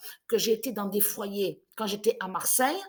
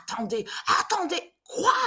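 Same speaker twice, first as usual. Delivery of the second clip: imitating a cry; 8 semitones higher, 4 dB louder than usual. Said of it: "elle a commencé à crier".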